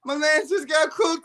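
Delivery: ordinary talk, nothing marked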